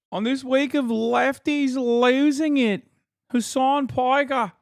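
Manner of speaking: exaggerated Australian broadcaster twang; mock Australian accent. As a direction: do a hammed-up Aussie news presenter. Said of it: "with this accent?".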